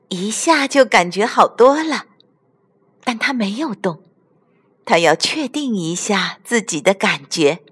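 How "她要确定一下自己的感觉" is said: ordinary delivery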